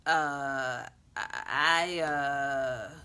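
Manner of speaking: halting, tongue-tied uh and I; stammering. Acted. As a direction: stumble over the words, caught off guard. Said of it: "he stammered".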